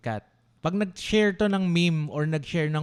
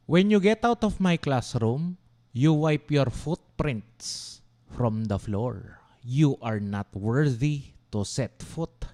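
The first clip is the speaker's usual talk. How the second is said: slow cold verdict; slower than usual. Retold or imitated. Professor's cruel words, slowed.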